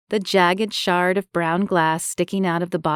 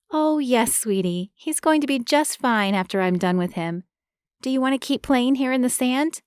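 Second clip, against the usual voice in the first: tender woman voice; 6 semitones higher than usual. Acted. Marie comforts boy.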